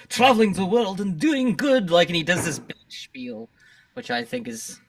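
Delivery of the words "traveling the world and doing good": slow, raised, grandiose impression; mock superhero. Co-host mimicking the philanthropist's spiel, hammy superhero voice. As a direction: proclaim it like a self-styled hero. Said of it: "like, he's a superhero or something".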